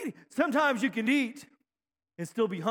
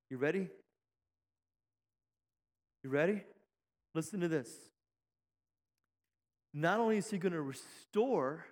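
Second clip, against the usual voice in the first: ordinary talk, nothing marked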